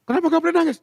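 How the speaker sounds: high voice of a crying wife; imitated upset wail; mock tearful protest. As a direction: protest through pretend tears. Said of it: "Jangan nangis".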